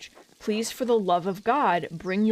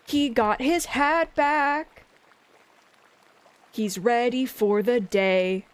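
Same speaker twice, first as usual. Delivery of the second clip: cutesy coo; 2 semitones higher, slower than usual. Real, not acted. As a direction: gush at it like a pet. Cooing over a frog picture.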